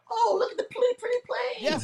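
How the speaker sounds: high, singsong gushing imitation; mock naive wonder. Chemtrail believer mimicking oblivious sky-watchers, high and mock-dreamy. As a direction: coo it very high, like a naive onlooker. Mocks people who pay no mind.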